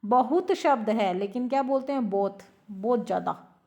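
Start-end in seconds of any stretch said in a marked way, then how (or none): none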